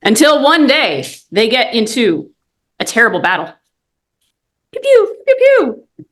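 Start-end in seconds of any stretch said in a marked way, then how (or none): 4.7-6.1 high squeaky laser pews; laser pew sounds. Acted. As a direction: make blaster noises, high pitched. Space battle sound effects.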